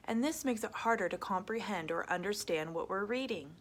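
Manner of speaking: usual speaking voice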